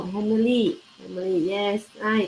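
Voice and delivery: ordinary talk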